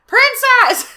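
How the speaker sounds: high, very loud cry; loud corrected take. Acted. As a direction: redo the line as a cry.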